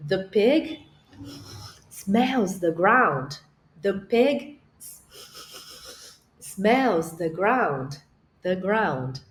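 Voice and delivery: very slow English, words spaced out; English intonation model. Shown as a demonstration. Teacher showing her voice changes in English, slow. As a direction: let the English melody carry it. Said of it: "o nosso timbre, a nossa entonação muda".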